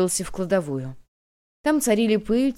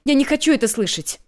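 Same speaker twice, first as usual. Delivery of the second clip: higher, louder desperate cry; shouting; 7 semitones higher, 5 dB louder than usual. A narrator's reading. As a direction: refuse to listen, yelling. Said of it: "закричала Инло". (отчаянный крик)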